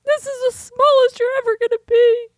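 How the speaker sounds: high tearful wail, re-enacted; squeaky, overcome with tears; mock crying. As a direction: wail it like a weepy new mother. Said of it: "I'll just start crying and I'll be like".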